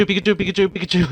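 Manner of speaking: high, loud squeaky Pikachu cry; Pikachu impression. Putting Pikachu in the song, loud squeaky Pikachu.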